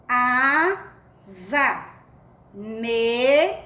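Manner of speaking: "Casa", "Rosa", "Musa", "Usa", "Asa", "Mesa": primeira sílaba esticada, pausa, "za" vibrante; leitura silabada. Shown as a demonstration. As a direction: leia cada palavra como "Caaa... za", "Rooo... za". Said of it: "Vamos ler mais uma vez". (palavras como "Casa" e "Mesa" silabadas, para alunos repetirem)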